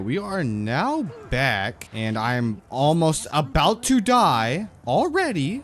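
ordinary talk

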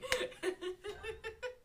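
high, trailing giggles; helpless laughter. Real, not acted. Gamer caught standing there cooking, high helpless laughter.

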